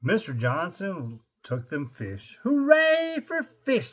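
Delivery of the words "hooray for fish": high squeaky seal cheer. Acted. Reader voicing the happy seals in a squeaky cheer. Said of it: "said the seals".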